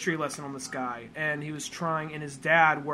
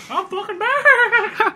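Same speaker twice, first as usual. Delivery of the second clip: much higher, louder, slow cartoon voice; silly triumphant shout; 12 dB louder, much slower than usual. Back from a coughing fit, squeaky cartoon shout. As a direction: announce your return like a cartoon hero.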